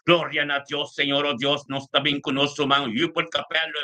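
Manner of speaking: ordinary talk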